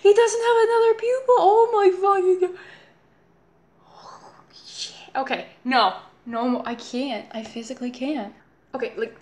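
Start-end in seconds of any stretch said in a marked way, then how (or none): 0.0-2.6 higher and quicker in disbelief; startled squeal of disbelief; shocked. Real, not acted. Shocked at creepy cartoon face. 3.8-5.0 slow breathless whisper; awed, horrified whisper. Real, not acted. Horrified at creepy face.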